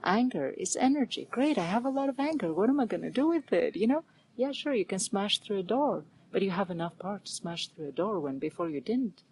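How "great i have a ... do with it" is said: cheerful. Retold or imitated. Mock cheerful acceptance.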